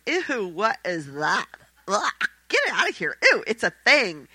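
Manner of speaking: hammed-up jealous sister grossed out; disgusted bad sister. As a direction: recoil from something filthy and shriek it away. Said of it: "the bad sister's going".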